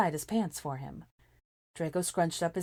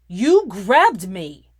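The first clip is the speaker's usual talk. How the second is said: slow, much louder retort; shot back; 4 semitones higher, 16 dB louder, much slower than usual. A narrator's reading. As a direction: spit it back with a glare. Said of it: "he shot back with a glare".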